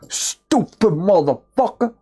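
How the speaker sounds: ordinary talk, nothing marked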